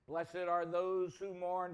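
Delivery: ordinary talk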